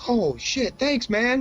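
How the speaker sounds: very high-pitched awed kid imitation; delighted game kid. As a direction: thank him like an amazed young gamer. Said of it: "the kid was like".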